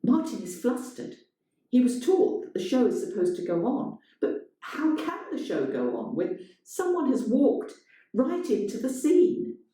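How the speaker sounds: plain reading voice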